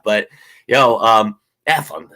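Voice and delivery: ordinary talk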